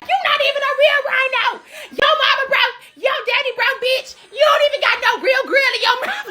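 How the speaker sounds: roast the rhino at the top of your voice; mock screaming. [name] going off on the rhino, high mock screaming. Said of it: "starts screaming at the rhino".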